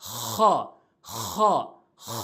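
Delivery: খ with long rasping friction in the throat; throaty kha. Shown as a demonstration. Teacher modelling how we will pronounce it, rasped খ. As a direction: scrape খ out from the end of the throat. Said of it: "এভাবে আমরা উচ্চারণ করব".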